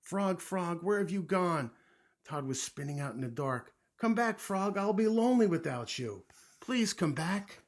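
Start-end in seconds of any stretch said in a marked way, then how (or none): 0.0-1.7 high, loud searching cry; anxious calling. A narrator's reading. Toad alone in the dark seeks Frog, anxious. 4.0-7.6 high, loud begging; plead, slowing on the last appeal; desperate plea. A narrator's reading. Toad fears loneliness without Frog, pleading.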